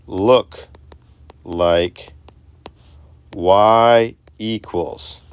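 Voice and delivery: each word slow and apart, "they don't look like y equals"; words spaced out. Shown as a demonstration. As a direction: lay every word down separately with pauses. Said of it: "slow, word-by-word delivery".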